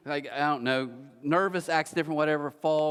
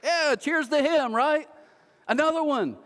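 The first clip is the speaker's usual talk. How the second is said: crowd cheering; 12 semitones higher, 4 dB louder than usual. Retold or imitated. Sinners in the crowd cheering Jesus, high and loud. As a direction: cheer like a rowdy crowd raising a glass. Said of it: "What do you think the Gomers in the crowd do?".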